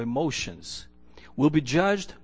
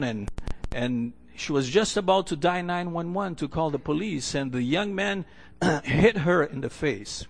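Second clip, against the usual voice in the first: normal talking voice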